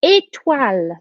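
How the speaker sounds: ordinary talk, nothing marked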